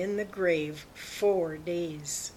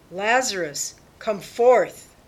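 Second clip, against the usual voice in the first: raised, commanding call; loud call; 4 semitones higher, 11 dB louder than usual. A narrator's reading. Jesus calling the dead Lazarus from the grave, loud call. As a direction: call out loudly, higher and forceful. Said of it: "he called out in a loud voice".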